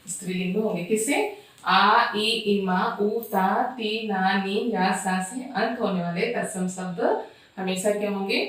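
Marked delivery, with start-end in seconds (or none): none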